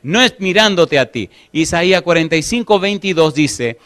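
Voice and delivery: ordinary talk, nothing marked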